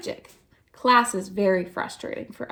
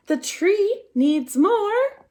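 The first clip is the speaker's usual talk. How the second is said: excited discovery; 9 semitones higher, 3 dB louder, much slower than usual. Acted. Esther announces her conclusion.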